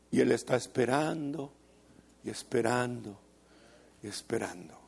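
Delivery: fading. Acted. Preacher describing God waiting patiently, fading to a hush.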